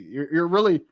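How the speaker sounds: normal talking voice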